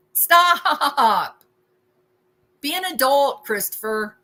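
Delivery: exasperated. Real, not acted. Exasperated at over-the-top intimidation.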